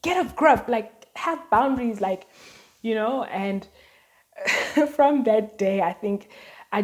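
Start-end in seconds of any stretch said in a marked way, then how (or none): 0.0-2.0 louder, scolding mother voice; upset mom. Retold or imitated. Mom tells teen daughter to get a grip, louder. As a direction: lecture her like a fed-up mom. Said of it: "very upset because she was like".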